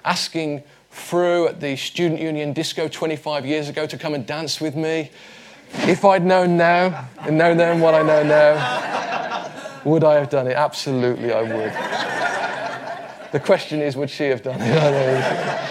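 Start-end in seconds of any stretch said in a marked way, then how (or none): 5.9-15.7 laughing. Real, not acted. Joking about asking wife to dance.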